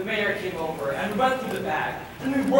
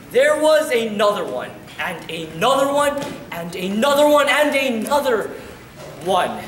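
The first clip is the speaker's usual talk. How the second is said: ANOTHER hit louder each round, then a drop on the last ONE; loud, rolling build on ANOTHER; mounting repetition; 2 semitones higher, 5 dB louder than usual. A narrator's reading. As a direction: pile up each ANOTHER insistently, pause before the final ONE. List of bags piles up.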